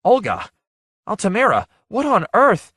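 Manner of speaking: high, loud startled cries; shocked outcry at the sight; gasping shock. Acted. Missus Chickering seeing her ragged friends, shocked and loud. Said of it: "She gasped".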